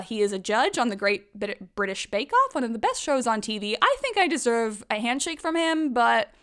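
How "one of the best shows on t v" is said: ordinary talk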